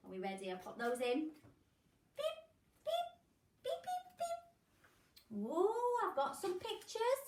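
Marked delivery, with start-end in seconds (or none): 2.2-5.4 high robotic beep, beep; squeaky toy-machine beeps. Pretend. 5.4-7.2 mock surprise. Machine produces pictures.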